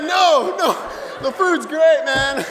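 high, loud mock-distressed protest; emphatic wailed denial. Crying guest reassures host brother, loudly wailed denial. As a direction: wail it out to reassure him.

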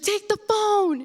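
high, loud urgent command; mom yelling. Retold or imitated. Mom ordering her daughter to grab the phone, high and loud. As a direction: bark it like a frantic mom.

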